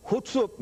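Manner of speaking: "Hutsup" said as one clipped word, high then falling; "Hutsup" in a broad northern English imitation, falling hard at the end; Yorkshire "Hutsup". Shown as a demonstration. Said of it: "means, uh, "What ails you?".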